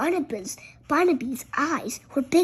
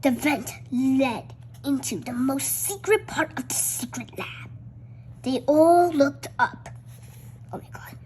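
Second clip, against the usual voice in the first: plain reading voice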